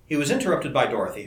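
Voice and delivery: ordinary delivery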